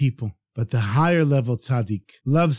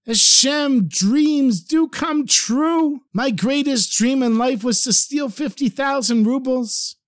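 high, elated thanks to God; grateful prayer; 7 semitones higher, slower than usual. Acted. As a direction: thank heaven like your dream just came true. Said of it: "thanking Hashem".

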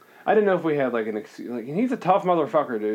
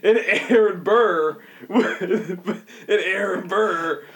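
slow, laughing through the name; real laughter; 4 semitones higher, much slower than usual. Real, not acted. Cracking up over Aaron Burr at the table, laughing. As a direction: struggle to get it out while laughing.

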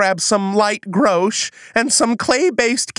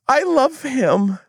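ordinary delivery

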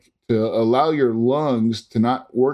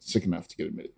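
much softer, lower echo of the doctor's words; soft low repeat for emphasis; quiet repeat; 6 semitones lower, 11 dB quieter, faster than usual. Retold or imitated. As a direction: say it again under your breath. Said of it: "basically is what he said".

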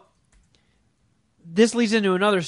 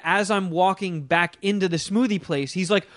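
ordinary talk

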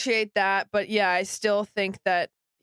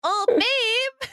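much higher, louder sweet coo; cooing Kim; 3 dB louder than usual. Retold or imitated. Mocking Kim cooing at her farting boyfriend, high coo. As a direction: coo it up high like a doting girlfriend. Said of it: "Kim was like".

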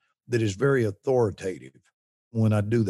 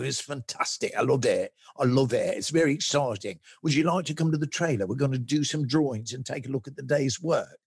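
lightly voiced enthusiastic English director; a hint of a gushing director praising the take; mild impression of an excited director; imitating Ridley Scott; 2 semitones higher than usual. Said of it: "he was like".

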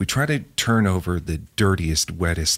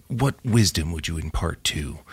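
ordinary delivery

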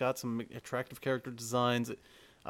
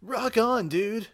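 laid-back surfer dude, loud start; 8 semitones higher, 3 dB louder, much slower than usual. Retold or imitated. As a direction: chill surfer bro impression. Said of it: "that's what he sounds like".

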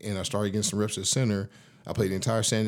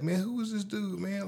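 high, softer voice of disbelief; baffled opponent; 11 semitones higher, 5 dB quieter than usual. Retold or imitated. Baffled opponent quoting himself over the newcomer, in high-pitched disbelief. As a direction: imitate a stunned rival, pitched way up. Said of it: "looked at me like".

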